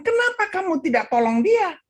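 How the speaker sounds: high-pitched, indignant retold demand; angry pastor. Pastor confronts the man who left the victim, angry. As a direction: imitate a pastor's outraged question. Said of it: "Marahlah ini pastor".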